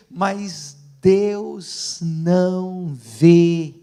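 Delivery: "Deus não vê" drawn out very slowly, each word loud; slow, weighty pulpit punchline; emphatic verdict. Real, not acted. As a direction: stretch and hit every word of "Deus não vê". Punchline after loud building list.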